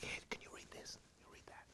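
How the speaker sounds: a breathless whisper, barely any voice. Retold or imitated. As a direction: whisper it so nobody outside the door hears. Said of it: "the sisters would teach me whispering".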